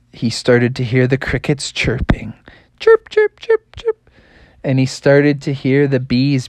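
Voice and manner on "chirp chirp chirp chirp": high squeaky chirp chirp; high little cricket chirps. A narrator's reading.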